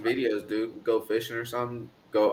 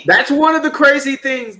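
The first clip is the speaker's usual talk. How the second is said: excited; 11 dB louder than usual. Real, not acted. Excited host shout.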